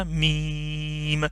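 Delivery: میم stretched very long and even; drawn-out meem. Shown as a demonstration. Teacher models the letter meem, slowly drawn. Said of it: "اور میم".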